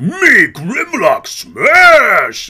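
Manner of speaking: loud, slow, high-pitched roaring brute; Grimlock. Acted. Quoting the Dinobots' leader from Transformers, bellowed battle cry. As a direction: roar it in slow, primitive bursts. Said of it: "he was always going".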